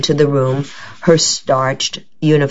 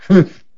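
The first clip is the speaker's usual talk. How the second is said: disbelieving humph; 5 semitones higher, 5 dB louder than usual. Acted. Matilda snorts in disbelief.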